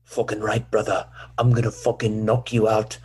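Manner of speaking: Irish fighter trash talk; steady threats in a put-on Irish accent; Conor McGregor. Host as fighter threatening an opponent, Irish accent impression. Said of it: "Conor McGregor?".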